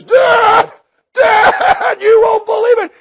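high, loud, bursting with news; excited shout. Acted. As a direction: burst in yelling to your father.

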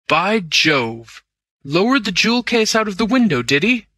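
high, exclaimed question; astonished. A narrator's reading. Lord Amersteth hearing how the case escaped, astonished. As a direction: echo the news with the pitch jumping up. Lord reacts to whispered news.

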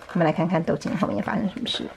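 plain reading voice

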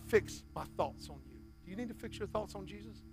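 ordinary delivery

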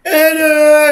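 long, high cackle fading out; helpless laughter into a squeal; laughing fit. Real, not acted. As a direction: laugh till breathless, then a high loud aduh.

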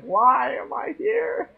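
high, slow dazed cat voice; voicing the cat. Streamer voicing her sleepy cat, high slow dazed voice. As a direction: play the groggy cat waking up. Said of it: "He's like".

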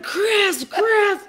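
high, frantic cry of a name; retold breathless-with-urgency shout; panicked calling. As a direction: call for help in mock panic. Retelling panicked shouts for Chris.

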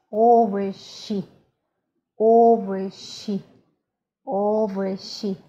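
"овощи" slow, with the O and "sh" drawn out; slow practice model. Shown as a demonstration. Teacher inviting learners to practise, slow, careful "овощи". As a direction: stretch each syllable of "овощи" for repeating.

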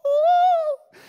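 high whooping cheer; party whoop. Shown as a demonstration. Showing how he loves a crowd, whooping. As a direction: let out a big party woo. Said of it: "I love to just be around people".

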